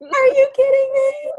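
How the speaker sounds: a slow, piercing mock squeal of disbelief; Vicky's catchphrase. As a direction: shriek it out like Vicky's new catchphrase. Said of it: "That's her new catchphrase".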